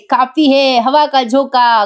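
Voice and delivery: ordinary talk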